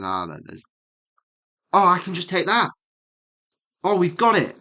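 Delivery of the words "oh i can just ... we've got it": real triumphant shout, much louder and higher; elated yelps of victory; excited. Real, not acted. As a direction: burst out in real delight at cracking it. Solving the chess puzzle, triumphant.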